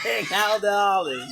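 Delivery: loud, high yell; shouting the order like a rowdy studio crowd; mock shout. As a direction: bellow the demand, hammed up. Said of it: "Maury Olive".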